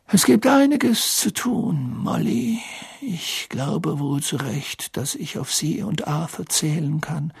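put-on high, slow, gentle old wizard; lightly voiced kind old headmaster; Dumbledore voice. A narrator's reading.

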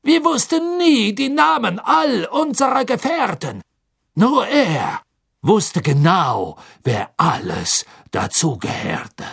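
hoch, gedehnt beteuernd; nervöser Karkaroff. Acted. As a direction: reuig bitten und beschwichtigen. Karkaroff spricht. (Karkaroff beteuert dem Gericht seine Reue)

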